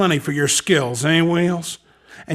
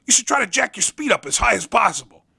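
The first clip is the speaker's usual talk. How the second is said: normal talking voice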